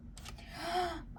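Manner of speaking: high, breathy surprised gasp; a quick intake of breath at a surprise; sharp gasp. Real, not acted. Reader spotting the bottom card, gasping in surprise.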